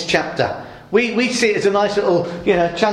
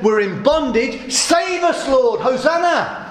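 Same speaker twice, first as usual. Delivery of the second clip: high, shouted plea that peaks on save us; desperate crowd cry; 5 semitones higher, slower than usual. Retold or imitated. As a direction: cry out for rescue from bondage. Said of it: "when the people said".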